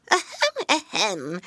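high squeaky crone throat-clearing; old woman's ahem. Acted. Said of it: "shook her head and said".

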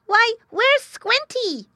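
very high, loud, slow piglet cry. A narrator's reading. Piglet notices brother is missing, crying out. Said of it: "Then Squealer cried".

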